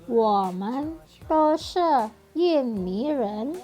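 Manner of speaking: very slow, word by word Mandarin reading; slow model sentence. Shown as a demonstration. Teacher models an example sentence very slowly for students. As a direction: spell out each syllable for the class. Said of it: "contoh berikutnya".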